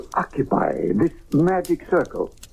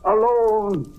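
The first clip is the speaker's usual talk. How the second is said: slow, rising ritual chant, high and ringing; solemn incantation; 9 semitones higher, slower than usual. Acted. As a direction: intone the summoning like a priest commanding a demon. Incantation summoning the spirit.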